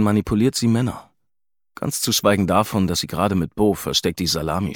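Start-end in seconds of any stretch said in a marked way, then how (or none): none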